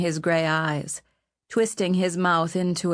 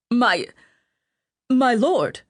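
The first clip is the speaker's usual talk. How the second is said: frightened stammer, pitched up; 5 semitones higher, 5 dB louder, slower than usual. A narrator's reading. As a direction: stumble over the words in fear. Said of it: "Ratcliffe stammered".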